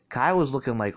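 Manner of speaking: ordinary delivery, nothing marked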